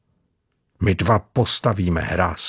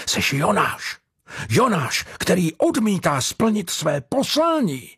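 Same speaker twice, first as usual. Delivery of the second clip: high, zealous denunciation; fervent accusation; 10 semitones higher than usual. A narrator's reading. Carpenter calls the departing boy Jonah, fervently accusing. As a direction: accuse him like a fanatic, rising higher.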